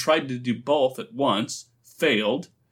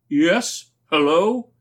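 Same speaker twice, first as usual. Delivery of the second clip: louder, higher questioning call; 5 semitones higher, 6 dB louder, slower than usual. A narrator's reading. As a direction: call back to someone across the way. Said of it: "he called".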